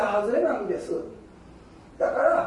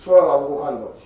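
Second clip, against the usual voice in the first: put-on low and louder, as a calm old sage; a grounded old master's pronouncement; Daruma speaking; 6 semitones lower, 5 dB louder than usual. Daruma reassuring the disciple, in a low, louder sage voice. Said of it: "達磨が言うと".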